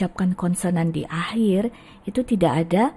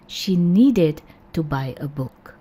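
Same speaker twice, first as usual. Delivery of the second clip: slow; slower than usual. Shown as a demonstration. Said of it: "pronunciation-nya berubah sedikit".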